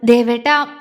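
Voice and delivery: calling out. Acted. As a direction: cut him off by name. Jwala stops Agni, calling out.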